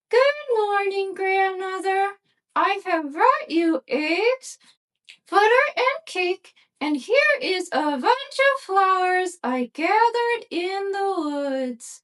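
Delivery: put-on high, slow, sweet little girl. A narrator's reading. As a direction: answer innocently in a high little girl's voice. Girl greets grandmother.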